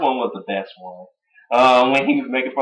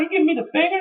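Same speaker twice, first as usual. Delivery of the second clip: high mock call; The Rock impression; much faster than usual. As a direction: call out in a squeaky voice. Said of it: "he's like".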